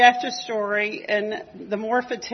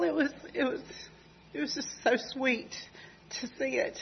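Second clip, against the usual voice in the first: tearful; 5 semitones higher, 7 dB quieter, faster than usual. Real, not acted. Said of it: "so I can quit crying".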